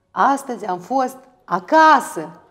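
Astăzi am fost acasă said slow and loud; emphatic capitals. Shown as a demonstration. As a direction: spell out the capitals with slow weight. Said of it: "cu litere mari".

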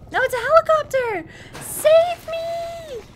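high, loud cry for help; calling for rescue. Pretend. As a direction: call up to the helicopter, climbing in pitch.

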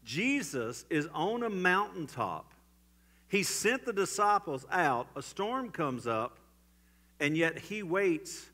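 rising pulpit intensity; preaching at full force. Acted. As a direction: preach it, pressing each phrase. Preaching the storm scene.

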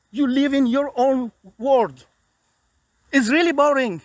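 bitter sarcasm. Real, not acted. Bitter appeal to the king.

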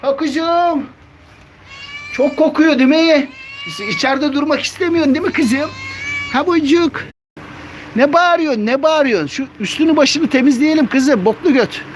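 very high squeaky pet talk. Real, not acted. Kediyle bebek sesiyle konuşuyor. (kediye çok tiz bebek sesi)

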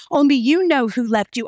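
usual speaking voice

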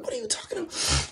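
high, soft squeal of disbelief; high-pitched mock bewilderment; bewildered protest. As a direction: imitate your own baffled protest. Retold bewildered reaction.

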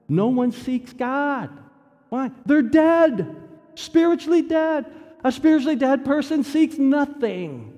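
impassioned preaching. Acted. Preaching at full force.

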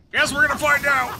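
loud, high gleeful shout; excited shout. Real, not acted. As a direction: yell it with glee. Setting the boss on fire.

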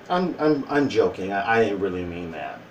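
low, soft mock backpedalling; sheepish retraction. Retracting the joke to a guy, low sheepish mumble. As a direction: back down meekly, dropping low and soft.

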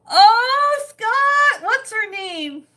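high, loud burst of delight; thrilled, squealing the question out high; excited. Real, not acted. Asking Scott about the new chicken, excited and high.

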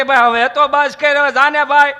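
loud, high, worn-out begging; fed-up vendor pleading loudly; exasperated pleading. Retold or imitated. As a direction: beg the kids to finally leave. Tired golawala begging kids.

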